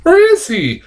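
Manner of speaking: put-on very high, pleading old lady voice. Reviewer voicing the ad's frightened old lady, high pleading. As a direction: squeak it out like a frail old woman. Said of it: "then she's like".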